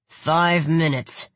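slow, sneering ultimatum. Acted. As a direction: sneer it out slowly. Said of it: "Khan sneered".

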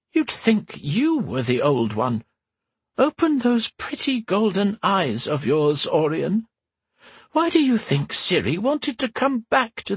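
put-on high creaky old empress, dry; old woman voice. A narrator's reading. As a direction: tart old woman's retort. Whisper's voice held.

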